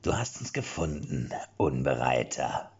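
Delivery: low, slowed menace, dragging out the name; guttural booming voice. A narrator's reading. Mercenary Jan looming over the cornered Erik, guttural and booming. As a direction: loom over him like a hulking mercenary. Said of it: "das gutturale Dröhnen von Jan Akula".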